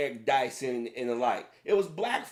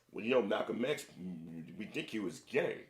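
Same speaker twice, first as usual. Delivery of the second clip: mock critic; 8 semitones lower, 6 dB quieter than usual. Mocking critics of Malcolm X.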